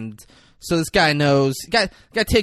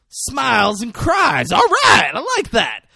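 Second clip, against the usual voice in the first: loud, high, drawn-out whoop of delight; exuberant approval; 9 semitones higher, 7 dB louder, slower than usual. Retold or imitated. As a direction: shout it like a hyped-up convert. Acting guy's loud delighted approval.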